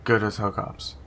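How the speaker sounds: normal talking voice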